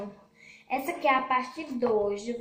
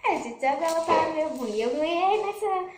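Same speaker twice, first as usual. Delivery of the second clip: shrieking jackpot winner; 6 semitones higher, 8 dB louder than usual. Real, not acted. Winning slime game, gloating.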